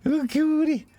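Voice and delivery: put-on very high, squeaky baby-talk voice; gushing falsetto over a cute dog; cooing at a doggy. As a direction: coo in a tiny high-pitched voice. Voices someone cooing at the dogs.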